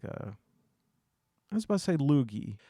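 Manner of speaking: normal talking voice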